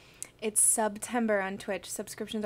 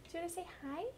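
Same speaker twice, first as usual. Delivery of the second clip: soft, much higher pet voice; 7 semitones higher, 9 dB quieter, much faster than usual. Real, not acted. Owner coaxing the dog to greet the viewers in baby talk. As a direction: coax the dog sweetly.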